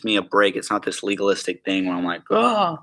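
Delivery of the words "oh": high cracking "oh". Real, not acted. Speaker mocking a legalistic burden, voice cracking. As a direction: voice leaps up and cracks. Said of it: "did my voice crack there?".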